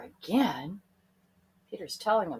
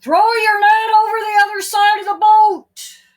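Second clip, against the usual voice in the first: higher, louder call across the lake; calling from shore; 13 semitones higher, 16 dB louder than usual. A narrator's reading. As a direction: call out from far away. The man's instruction from shore.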